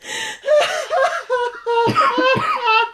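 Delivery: high squealing laugh; laughing fit. Real, not acted. Host cracking up at her own Dorothy bit, squealing laugh.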